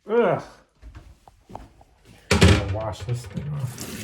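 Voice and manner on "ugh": loud, high disgusted groan. Real, not acted. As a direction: recoil from the stench. Reacting to washer stench.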